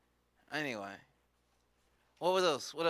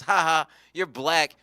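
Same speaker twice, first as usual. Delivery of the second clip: louder schoolyard jeer; taunting comeback; 8 dB louder than usual. Retold or imitated. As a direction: throw the mocking "ha ha" back. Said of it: "He goes".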